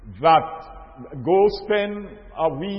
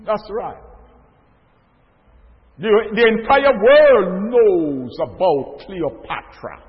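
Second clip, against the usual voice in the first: raised, slow preacher's build to the congregation; preaching; 4 semitones higher, 6 dB louder, slower than usual. Acted. Preacher rousing his brothers and sisters, slow and raised. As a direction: lean into every phrase like a pulpit sermon.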